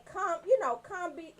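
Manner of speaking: normal talking voice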